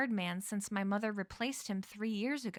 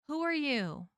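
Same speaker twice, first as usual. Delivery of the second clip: raised, louder demand; 6 semitones higher, 5 dB louder than usual. Acted. Bronwyn confronts the stranger with the flashlight, demanding. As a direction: demand an answer, high and loud. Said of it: "Bronwyn demanded".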